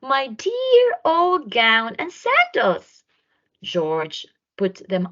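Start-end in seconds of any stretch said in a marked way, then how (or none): 0.0-3.0 high, slow, fond exclamation; delighted. A narrator's reading. George greets his dear old gown and sandals, high and delighted. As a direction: savor each word of relief.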